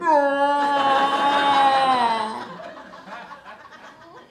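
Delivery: high, loud burst of held breath; loud charged exhale. Retold or imitated. Fat kid releasing held breath, loud snorting gasp. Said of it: "I'd be like".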